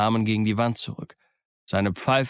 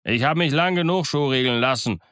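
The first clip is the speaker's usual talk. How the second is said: slightly raised, higher pirate protest; indignant, higher-pitched outcry; called-out protest; 5 semitones higher, 4 dB louder than usual. Acted. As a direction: call out your grievance. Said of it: "rief ein anderer".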